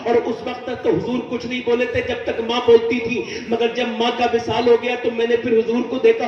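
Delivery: usual speaking voice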